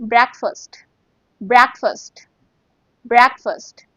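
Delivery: "breakfast" said BREK-fust, clipped; "breakfast" pronounced brek-fust; correct pronunciation. Shown as a demonstration. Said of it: "You have to say".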